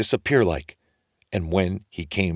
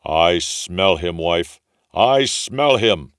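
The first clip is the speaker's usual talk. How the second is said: slow, loud giant sniffing out prey; slow ogre growl; 4 dB louder, slower than usual. A narrator's reading. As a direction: drag out each word like a menacing giant.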